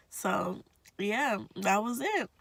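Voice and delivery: normal talking voice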